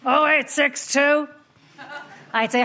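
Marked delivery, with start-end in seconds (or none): 0.0-1.3 slow, formal phone voice with a throat-clearing "Ah"; an old Irish dad picking up the phone, slow and stiff; father answering the phone. Retold or imitated. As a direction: clear the throat, then read out the number slowly. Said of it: "he answered the phone like this".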